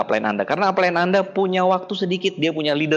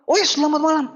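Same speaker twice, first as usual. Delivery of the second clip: upline answering; 10 semitones higher than usual. Retold or imitated.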